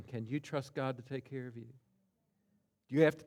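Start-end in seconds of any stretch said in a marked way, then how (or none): 0.0-1.7 softer, more intimate repeat; preacher quietly repeating the question to the congregation. Shown as a demonstration. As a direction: ask it again gently and softly. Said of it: "Let me ask it again".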